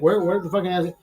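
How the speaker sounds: normal talking voice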